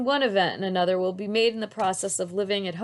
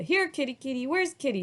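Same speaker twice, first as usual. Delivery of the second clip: calling a kitty; 6 semitones higher than usual. A narrator's reading. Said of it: "he calls".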